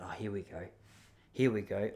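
under his breath, bracing for trouble; dread. Retold or imitated. Bracing as the stranger turns back, quiet dread.

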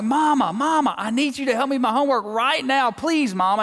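put-on high, insistent little girl begging; pleading kid. Daughter at the kitchen table pleading with mom, high. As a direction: beg like a kid who needs help now. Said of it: "She's like".

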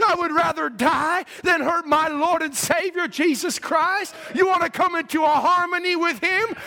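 loud, high preacher's climb; fiery preaching. Real, not acted. Preacher rousing the congregation, loud and high. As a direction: preach it out full force to the church.

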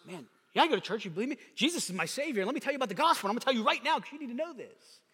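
pushy, excited believer talking fast; bold evangelist. Pretend. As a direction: push the gospel on them, rapid-fire. Bold believer preaching eagerly.

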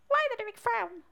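high squeaky little frog. Acted. Frog asks why the mole frowns, high squeaky voice. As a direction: ask it in a piping frog voice. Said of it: "said the frog".

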